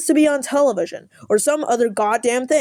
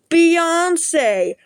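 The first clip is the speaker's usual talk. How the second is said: excited shout; 5 semitones higher than usual. Acted. Exclaiming the star's name.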